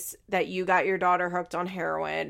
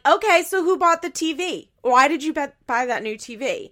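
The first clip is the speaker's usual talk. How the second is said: raised, accusing Barbara voice; mock confrontation; 6 semitones higher, 4 dB louder than usual. Mother confronts daughter about the new TV, mock accusing. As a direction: demand it like a nagging mother.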